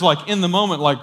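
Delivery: ordinary delivery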